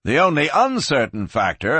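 plain reading voice

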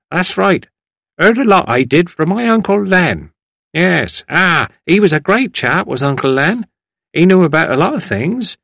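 put-on high, rambling old man's voice; chatty elder recalling local history; old man reminiscing. A narrator's reading. Old man reminisces about uncle.

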